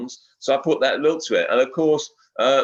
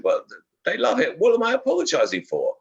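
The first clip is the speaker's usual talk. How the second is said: retold protest, pitched well up; indignant protest; 12 semitones higher than usual. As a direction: protest in a high, incredulous voice. Retells own protest at forced apology.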